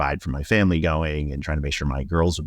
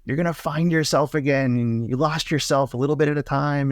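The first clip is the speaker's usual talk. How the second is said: much higher, bright with joy, like a puppy on Christmas morning; beaming excitement; 9 semitones higher than usual. Retold or imitated. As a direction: pour hope onto a stranger, buoyant and high. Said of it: "full of light and energy and beaming and excitement".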